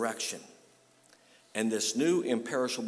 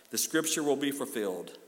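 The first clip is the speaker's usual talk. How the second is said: usual speaking voice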